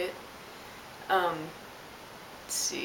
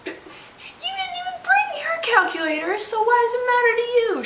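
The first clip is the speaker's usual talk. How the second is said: much higher, louder, squealing indignation; mock outrage; 5 dB louder than usual. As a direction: shriek at him in mock fury. Imagined rant at the guy.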